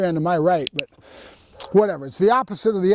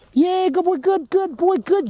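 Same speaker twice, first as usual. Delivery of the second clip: high, bright-eyed praise for the pup, pitched way up; excited puppy praise; 10 semitones higher, slower than usual. Real, not acted. Trainer hypes up his puppy over the toy, excited high praise. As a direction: cheer the puppy on like he just won.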